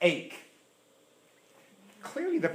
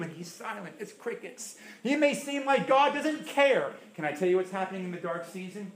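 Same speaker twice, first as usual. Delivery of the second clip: ordinary delivery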